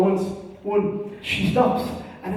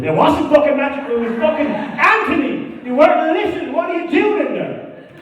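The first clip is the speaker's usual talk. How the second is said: high, loud hammed-up telling off; hammed-up indignant interviewer rant; mock scolding; 9 semitones higher, 4 dB louder than usual. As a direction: scold them like a mock outraged reporter.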